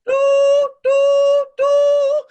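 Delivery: loud, very high trumpeting; trumpet call. Acted. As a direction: blast it like a herald's trumpet.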